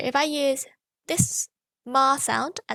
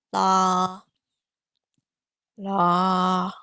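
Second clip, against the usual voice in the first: low, louder la; bottom pitch la; 5 semitones lower, 4 dB louder than usual. Shown as a demonstration. Teacher finding the bottom pitch for students. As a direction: sink to the bottom of the range. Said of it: "locate your bottom pitch".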